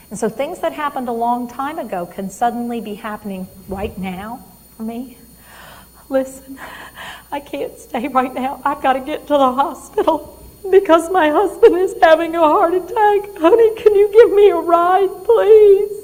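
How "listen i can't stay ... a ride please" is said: high, loud, pleading for a ride; panicked wife begging for help; frantic plea. Acted. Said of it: "when I'm getting emotional".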